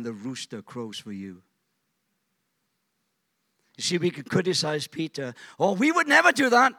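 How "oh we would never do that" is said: high, loud mocking oh; sarcastic church voice; mock boast. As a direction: scoff at the proud claim.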